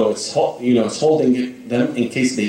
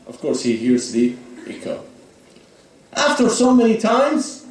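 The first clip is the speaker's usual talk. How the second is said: ordinary delivery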